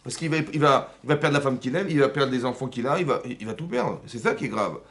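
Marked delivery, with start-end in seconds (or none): none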